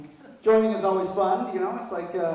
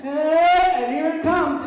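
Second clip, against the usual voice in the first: slow build-up; 6 semitones higher, 7 dB louder, slower than usual. Retold or imitated. Building to the climax.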